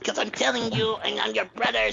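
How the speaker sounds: mock Caribbean island accent, pitched high; fake island accent. Brother mimicking his sister arguing back, fake island accent. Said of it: "She got this fake ass, uh".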